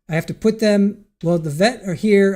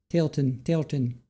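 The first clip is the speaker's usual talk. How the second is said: ordinary talk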